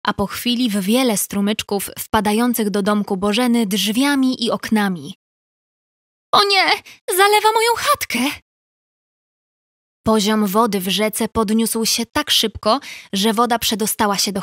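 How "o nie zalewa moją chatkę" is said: high, fast panicked outburst; high frightened beaver, rushing; alarmed cry. Acted. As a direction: cry out as the water pours in. Beaver sees flood.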